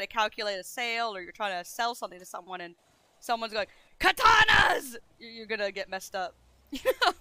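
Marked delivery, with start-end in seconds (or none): 4.0-4.9 high, very loud shout; full yell. Acted. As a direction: holler it out like a war cry. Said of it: "You never wanna yell here".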